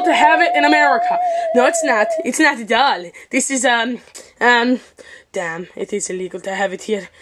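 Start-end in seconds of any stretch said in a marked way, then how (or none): 5.3-7.1 mild hushed worry. Acted. Realizes panda is illegal.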